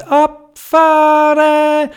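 high, loud, very drawn-out launch shout; departure call. A narrator's reading. Theobald launches the sled with four riders, long high call. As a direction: call all aboard, holding the last vowel long. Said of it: "Und der Theobald hat gerufen".

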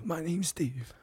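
whispered timid reply; hushed nervous servant. Pretend. Cook answers queen timidly.

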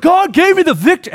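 high mock-pious imitation; high, lightly mocking voice. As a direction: parody a smug winner's thanks. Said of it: "We're just like".

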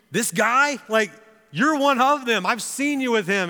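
high, loud pointed accusation; accusing girl. Retold or imitated. Girl recognizes Peter in the courtyard, accusing. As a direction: act out a girl singling someone out. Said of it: "she looks at Peter and goes".

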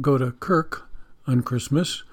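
"kirk" for church, CH said as K; hard CH in church. Shown as a demonstration. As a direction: say church as "kirk", matching the K of "Christmas". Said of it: "C H, you know, that can go whatever way".

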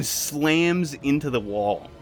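ordinary delivery

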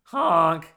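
loud, high goose honk. Retold or imitated. Dad imitating goose honk.

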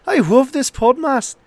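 put-on high, slurred drunken Irish voice, slowing down on the last words; a pub regular reading a fan letter in a drunken Irish accent. Reading a listener's review on the podcast, high and slurred in a drunken Irish accent. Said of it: "do this review in a drunken Irish accent".

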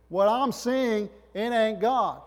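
loud, high, drawn-out declaration; acted preacher's proclamation; emphatic denial. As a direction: proclaim the denial slowly and forcefully. Repeats disciples' denial after aside.

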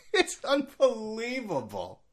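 high, slow, stretched-out disbelief; soft, incredulous wail; disbelieving. Real, not acted. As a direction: draw it out high, then let it fall. Exasperated disbelief.

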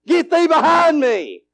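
high, slow preacher's command; commanding rebuke. Acted. Preacher rebuking Satan in Jesus' name, commanding.

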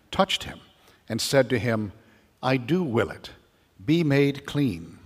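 formal, deliberate proclamation; gospel reading. Acted.

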